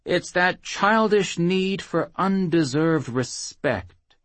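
slow, high droning sneer. Acted. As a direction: drone on condescendingly. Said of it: "Bermudo droned on".

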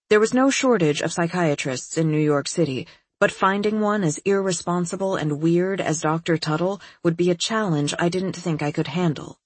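ordinary delivery, nothing marked

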